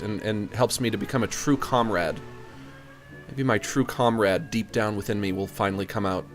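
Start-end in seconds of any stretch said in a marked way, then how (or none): none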